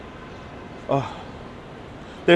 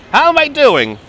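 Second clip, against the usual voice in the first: higher, louder Koch-style How am I doing; Mayor Koch catchphrase; 10 semitones higher, 5 dB louder than usual. Pretend. Guide imitating Mayor Koch's catchphrase for viewers. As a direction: belt out the mayor's famous line. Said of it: "You met Mayor Koch".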